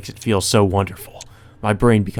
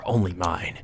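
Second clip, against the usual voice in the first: "Only mine." dropped much softer; possessive murmur; 2 semitones lower, 7 dB quieter, slower than usual. Acted. Killer claiming his sister, "Only mine." in a soft murmur. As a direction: breathe "Only mine." like a secret craving.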